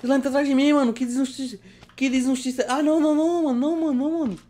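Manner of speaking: high, loud panicked whine; panicking gamer. Real, not acted. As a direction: panic as the slime closes in. Slime chasing him in game.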